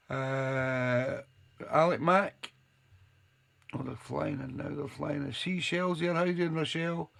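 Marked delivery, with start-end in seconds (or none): none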